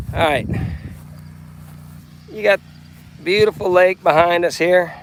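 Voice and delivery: loud rancher, mild west Texas twang; grumpy grandpa voice. Pretend. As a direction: boom it out like a ranch host. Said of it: "I'm acting like I'm on Grumpy Grandpa Patriot Show".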